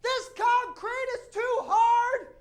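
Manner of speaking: high, slow, exaggerated angry woman at pigeons; ranting woman. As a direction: ham up a furious lady yelling at birds. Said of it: "and she's saying".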